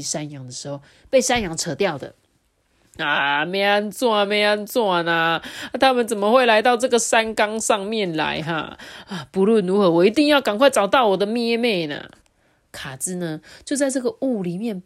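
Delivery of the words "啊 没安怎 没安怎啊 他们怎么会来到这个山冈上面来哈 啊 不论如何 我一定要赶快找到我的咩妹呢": worried wolf talking to himself, a bit louder; a hint of a nervous young wolf; anxious gentle wolf. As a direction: fret about finding his friend. Kazi worried in the fog.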